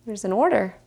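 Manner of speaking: plain reading voice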